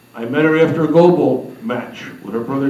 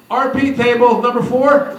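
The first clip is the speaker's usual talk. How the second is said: higher-pitched mock call-out; mock announcement; 7 semitones higher than usual. Mary assigning the blind guest a table, mock call-out. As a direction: imitate someone calling out a table assignment.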